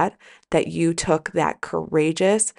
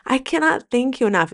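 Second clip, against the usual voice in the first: choking up; 6 semitones higher than usual. Real, not acted. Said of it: "see, I'm gonna cry".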